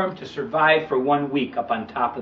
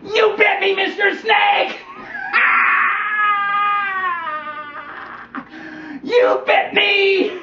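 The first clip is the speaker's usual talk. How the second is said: put-on anguished boy; slower than usual. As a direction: wail at the betrayal. Boy cries out bitten.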